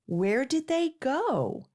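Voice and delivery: ordinary delivery, nothing marked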